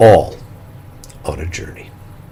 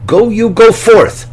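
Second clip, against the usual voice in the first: slow, louder, booming command; God's commanding call; 10 semitones higher, 10 dB louder, slower than usual. Acted. Preacher voicing God's call to Abraham, slow and booming. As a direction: call out like the voice of God, drawing it out.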